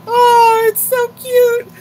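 high, loud gush of delight; high loud squeal of delight. Real, not acted. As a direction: squeal over how cute it is. Gushing over smiling dog.

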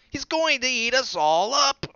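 high, loud panicked warning; loud high alarmed child voice; alarmed little bunny. A narrator's reading. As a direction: shout the warning like a scared child. Dot warns about the wolf.

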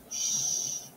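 whispered shh; mother calming her crying son, whispered shh; soft shush. Acted. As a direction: hush a crying child gently. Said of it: "she said".

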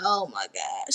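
hushed shocked aside. Retold or imitated. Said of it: "And I'm like".

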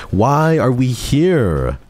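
high, drawn-out exasperated wail; loud, stretched-out cry of protest; overreacting. Real, not acted. Streamer overreacting to the game, high drawn-out wail. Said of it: "I'm overreacting".

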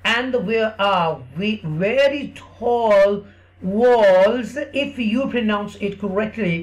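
slow, drawn-out English story voice; teacher's slow storybook English; slow story telling for kids. Acted. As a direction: tell it slowly to a small child.

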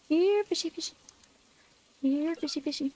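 high, sing-song fish call; coaxing call. Pretend. Calling the fish while fishing.

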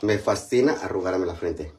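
usual speaking voice

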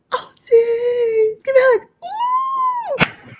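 very high, loud squealing; excited squeal. Pretend. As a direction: squeal with delight for a hug. Squealing for a hug.

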